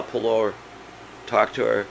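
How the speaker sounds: usual speaking voice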